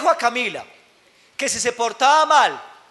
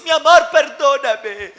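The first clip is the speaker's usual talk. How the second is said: apologetic parent; 5 semitones higher, 6 dB louder than usual. Retold or imitated. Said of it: "otros que le piden perdón".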